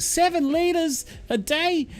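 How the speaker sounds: high, slow disbelieving question; incredulous. Retold or imitated. Viewer amazed at a syrup maker's daily yield, incredulous. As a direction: squeak it out in drawn-out astonishment.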